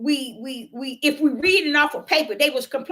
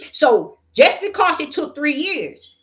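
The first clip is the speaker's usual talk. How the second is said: usual speaking voice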